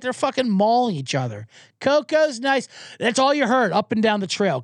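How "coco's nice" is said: mock dog owner. Mocking the owner on the trail.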